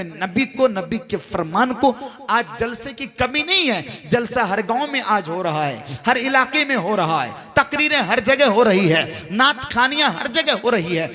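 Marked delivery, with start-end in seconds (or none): none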